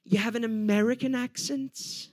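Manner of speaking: put-on slow British lilt rising on "accent."; imitated upward inflection. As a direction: let "accent." curl upward like a backhanded compliment. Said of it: "They do that upward inflection thing".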